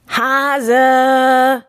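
loud, high, long drawn-out shout; bear bellow. A narrator's reading. Bear names the hare as culprit, drawn-out bellow. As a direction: bellow it out, holding the word.

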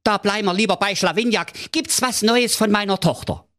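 high fussy king voice. A narrator's reading. King speaks on.

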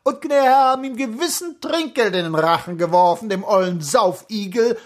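high-pitched indignant cloakroom woman; worked-up indignation. A narrator's reading. Cloakroom woman railing about drunken Anton, worked-up indignation. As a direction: get heated, voice pitched right up. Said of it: "ereiferte sich die Gubalke".

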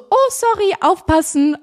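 high-pitched mock apology; exaggerated, high imitation of a nail technician; mimicked nail tech. Mimics careless nail tech's apology.

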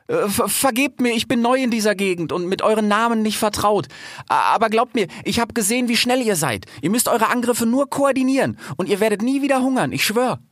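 high, stammering apology; nervous fox. A narrator's reading. Newcomer fox apologises to the cheetahs, high nervous pleading. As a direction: plead earnestly, stumbling into phrases.

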